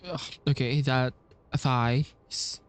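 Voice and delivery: ordinary talk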